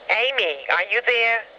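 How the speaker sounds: ordinary talk, nothing marked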